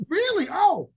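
high, loud, drawn-out disbelief; sarcastic surprise. Retold or imitated. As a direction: stretch out the mock amazement. Acted sarcastic reaction continues.

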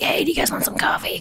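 husky whispery morning-after voice; breathy rasp, just woke up after partying; party girl voice. Retold or imitated. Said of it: "I call it my party girl voice".

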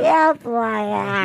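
lightly voiced dreamy contestant; spaced-out impression. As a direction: drift through it like on pills. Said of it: "like a Jennifer Coolidge character".

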